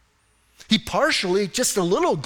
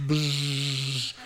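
long buzzing lip noise; spaced-out buzz; 4 semitones lower, 4 dB quieter than usual. Acted. Preacher mimics listeners spacing out, droning buzz. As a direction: buzz like a brain tuning out. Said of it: "you're, like, spacing".

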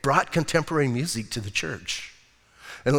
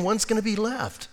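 ordinary delivery

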